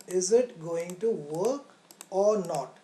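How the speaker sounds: ordinary delivery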